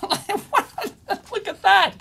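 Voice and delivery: high, drawn-out laughing exclamation; laughing amazement. Real, not acted. Reviewer seeing the gadget he was sent, laughing amazement. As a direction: laugh as you marvel at it. Delighted at the device he unpacks.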